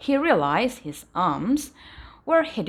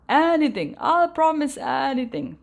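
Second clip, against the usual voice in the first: higher, louder desperate plea; pleading; 6 semitones higher, 4 dB louder than usual. A narrator's reading. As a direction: beg him to stop, anything. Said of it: "pleaded the dragon".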